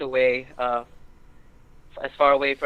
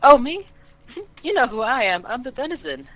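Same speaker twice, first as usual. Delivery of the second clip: put-on much higher, louder playful girl voice; denizen girl; 10 semitones higher, 4 dB louder than usual. Maid denizen answering who she is, high and playful. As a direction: chirp it out, delighted to be asked.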